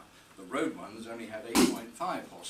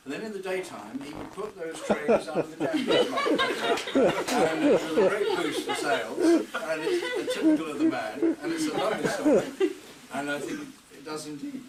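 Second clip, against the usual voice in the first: laughing; 10 semitones higher, 9 dB louder than usual. Real, not acted. Punchline of the tray story.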